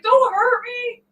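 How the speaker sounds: high, slow mock plea; mock pleading. Imitating her husband begging not to be hurt, high slow mock plea. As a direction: beg like a scared kid, hammed-up. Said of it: "He's like".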